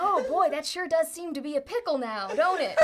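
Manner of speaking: hammed-up cowboy; folksy cowboy. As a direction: play a cowboy remarking on trouble. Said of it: "very cowboy hat steps up".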